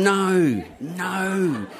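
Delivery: high, drawn-out inner wail; mock dismay. Man caught in alley by police, comic dismay. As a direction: replay a sinking 'oh no' for laughs.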